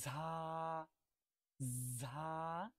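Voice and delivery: ঝা held long and even; jha demo. Shown as a demonstration. Teacher repeating ঝা for students to copy. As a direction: model ঝা clearly for the class. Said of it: "আমরা এভাবে বললাম যে ঝা".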